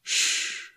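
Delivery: soft warning "shh"; cautioning shush. A narrator's reading. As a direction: hush someone quietly. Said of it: "cautioned Clayton".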